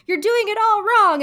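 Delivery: high, loud mock yell of a tap teacher. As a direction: bark the correction up high and loud. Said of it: "the teacher is yelling at her".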